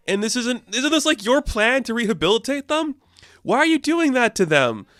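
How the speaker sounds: high, outraged mock scolding of a friend; shocked scolding. The team scolds B for punching the villain, outraged and high-pitched. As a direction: pitch it way up, aghast and scandalized.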